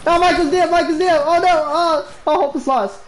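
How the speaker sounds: ordinary delivery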